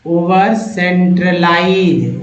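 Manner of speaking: ओवर centralise said slowly, each syllable drawn out; slow model reading. Shown as a demonstration. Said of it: "teacher models word slowly". (धीरे-धीरे, शब्द को खींचकर बोलिए)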